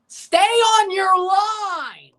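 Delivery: high, loud, very slow and drawn out; frustrated yell. Real, not acted. Fan ordering the keeper to stay home, drawn-out yell. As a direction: stretch each word out at the keeper. Yelling at goalie on TV.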